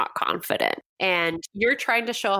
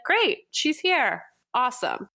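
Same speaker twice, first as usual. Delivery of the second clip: lightly voiced pleased follower, higher and drawn out; pleased audience reaction; 5 semitones higher than usual. Coach voices followers seeing her show up, mildly pleased. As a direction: lift the pitch, easy and slow, as an approving follower. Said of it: "they're gonna be like".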